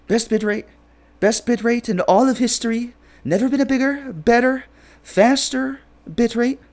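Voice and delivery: high, boastful, stretched-out Trump brag; Trump impression. Streamer hams up Trump bragging about the bitrate, high and slowing. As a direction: keep climbing, each word bigger. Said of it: "Trump has a voice".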